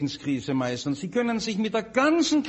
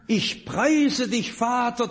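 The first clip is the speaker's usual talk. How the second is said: slow, higher-pitched proclamation of scripture; proclaiming; 4 semitones higher, slower than usual. Retold or imitated. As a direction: proclaim the praise to the congregation, drawn out. Preacher proclaims Jesus' praise. (feierlich gedehnt verkündet)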